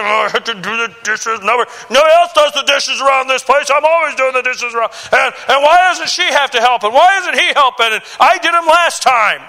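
grumbling complaint. Acted. Imitated kid griping to parents about doing the dishes, grumbling. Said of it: "grumbling and complaining".

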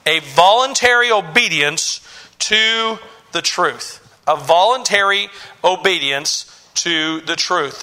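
"voluntary obedience to the truth" said slowly, phrase by phrase; weight on "voluntary", with pauses before "obedience" and "to the truth"; emphatic. Shown as a demonstration. Preacher defining virtue for the congregation, "voluntary" and "obedience" slowly emphasized. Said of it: "put a lot of emphasis on this".

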